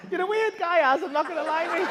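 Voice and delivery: high, loud, tickled voice; amused teasing. Real, not acted. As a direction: blurt it out high, thoroughly tickled by him. Amused teasing of audience member.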